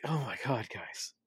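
high, fed-up eye-roll sigh; exasperated aside to everyone. Retold or imitated. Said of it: "it's like".

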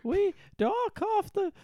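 loud, very high mock English warble; runaway Penny accent. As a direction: ham up a grand English lady, way up high. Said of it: "this accent's really getting away from us".

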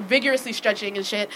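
ordinary delivery

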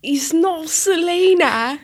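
slow, high put-on pop star protest; mock pop star voice. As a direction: tease in a celebrity voice.

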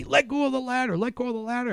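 high-pitched imitation of yelling, no real volume; mock yelling. Lifeguard yelling at a swimmer on the ladder, mock yell. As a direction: imitate someone yelling orders from poolside. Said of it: "They're yelling at me".

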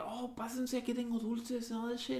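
put-on soft, high sweet-old-man voice; a hint of a sweet old Mexican grandpa; grandpa's voice. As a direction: coax the kids in gently like a grandpa. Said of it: "And he'd always be like".

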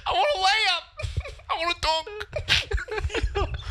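a mock sobbing Aussie wailing about basketball; Ben Simmons as Aussie. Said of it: "You sound".

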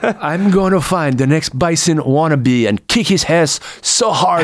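put-on rally the troops in a Van Damme accent; Van Damme impression. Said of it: "the best Van Damme impressions".

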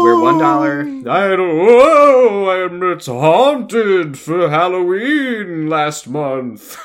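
high, loud spooky ghost voice; haunt the line like a ghost; ghostly voice. Pretend. Haunted for Halloween.

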